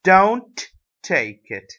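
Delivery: "Don't" loud and drawn out, then "take it"; stress on don't. Shown as a demonstration. Teacher modelling a negative instruction for learners, stress on "Don't". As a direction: slowly hit "Don't" hard, setting it apart. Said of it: "The stress is on the word don't".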